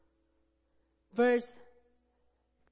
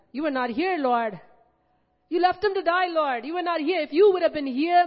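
building to a loud, high reproach; a sister rebuking the Lord at full force; anguished accusation; 4 semitones higher, 6 dB louder than usual. Acted. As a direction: let her grief rise into blame. Preacher voices Mary's grieving complaint.

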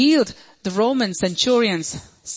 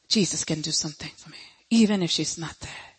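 whispered; 5 semitones lower, 7 dB quieter, faster than usual. Retold or imitated. Woman's quiet faith aside.

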